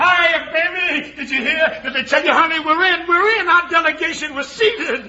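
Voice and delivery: high, laughing, jubilant news; excited. Acted. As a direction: burst in overjoyed with good news. Chuck bursting in with news.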